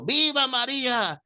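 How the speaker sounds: mock shout of devotion; retold shouting. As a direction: belt it out like a stubborn old man. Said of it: "me gritaba el viejecito".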